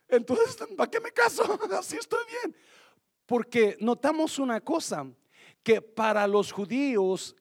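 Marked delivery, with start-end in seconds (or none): 0.1-2.5 dismayed disciples. Retold or imitated. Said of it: "los discípulos cuando".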